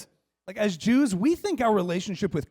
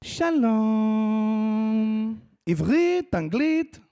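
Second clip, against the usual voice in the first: drawn-out Israeli greeting, then high questions; El Al interrogator; 3 semitones higher, much slower than usual. Retold or imitated. Interrogator greeting traveling family, probing. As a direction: question like an Israeli security screener. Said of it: "She's just like".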